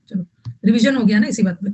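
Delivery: ordinary delivery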